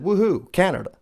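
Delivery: high, proud little woohoo; proud cheer. Retold or imitated. Canadian pupil cheering Canada in class, proud. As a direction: cheer for your own country, pitched high. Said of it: "had a moment of pride".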